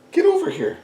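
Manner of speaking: high call to the dog. Real, not acted. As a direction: coax the dog over, high. Calling the dog over.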